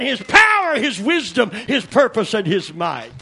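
high, loud shouted preaching; climbing sermon shout; preaching at full force. Acted. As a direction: whoop it up like a revival preacher.

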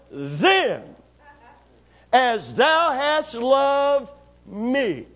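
slow, loud, drawn-out preaching of the prayer; solemn scripture reading. Acted. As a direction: proclaim every word like a pulpit prayer. Preacher reads Jesus' prayer aloud.

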